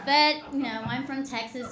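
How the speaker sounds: ordinary talk, nothing marked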